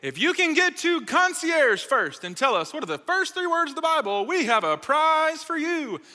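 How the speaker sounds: high-pitched announcer imitation, stretched at the end; store intercom announcer. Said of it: "over the intercom they say".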